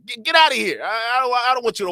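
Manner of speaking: mock rebuff. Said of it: "They rebuffed him".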